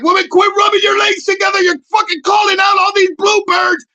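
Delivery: high, loud, screechy scolding; mock yell. Yelling at the hairy-legged woman in the airport, high and loud. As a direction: holler at her like birds are dying. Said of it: "I was like".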